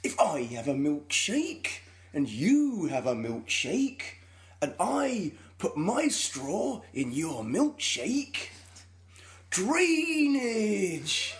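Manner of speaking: slow, menacing drawl climbing high on drainage; a slow, sinister oilman; Daniel Day-Lewis impression. Doing There Will Be Blood for Joel, slow menacing drawl. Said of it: "my impression of Daniel Day-Lewis in There Will Be Blood".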